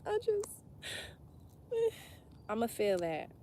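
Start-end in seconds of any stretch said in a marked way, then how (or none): 0.1-0.5 high cracked voice as if about to cry; voice breaking. Real, not acted. Reader admitting she cries, voice breaking.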